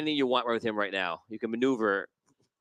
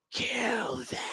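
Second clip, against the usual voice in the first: high, softer creature command; eerie telepathic order from the monster; voice in the head; 11 semitones higher, 6 dB quieter than usual. Acted. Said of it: "hear her in, in both your heads".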